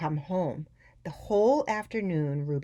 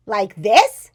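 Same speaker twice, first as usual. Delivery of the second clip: blaring, higher-pitched question; loud child; 4 semitones higher, 15 dB louder than usual. A narrator's reading. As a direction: boom it out, oblivious to volume. Loud Ruby answers her parents.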